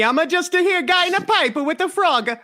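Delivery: squeaky cartoon Italian falsetto; Mario. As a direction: play an affronted cartoon plumber, very high. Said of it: "D B Mario would have a lot to say".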